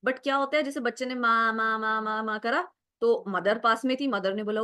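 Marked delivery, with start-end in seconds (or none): 1.1-2.4 even string of repeated "माँ"; demonstrated babbling. Shown as a demonstration. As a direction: chain "माँ" over and over like babbling. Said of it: "The kid is just babbling here". (बच्चे की babbling जैसा दोहराया हुआ "माँ")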